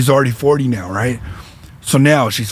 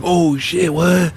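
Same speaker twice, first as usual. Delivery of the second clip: mildly acted astonishment, pitched up; retold surprise; 3 semitones higher, much slower than usual. [name] hears the homegirl is pregnant, mock surprised. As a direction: replay a stunned reaction, without shouting. Acting own reaction to the news.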